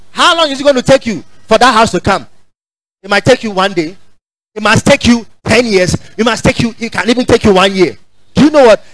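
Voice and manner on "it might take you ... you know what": ordinary talk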